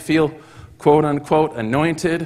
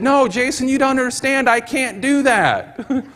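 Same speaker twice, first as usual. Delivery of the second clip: stunned protest; 10 semitones higher than usual. Retold or imitated. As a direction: insist you can't, amazed. Joe protesting in disbelief.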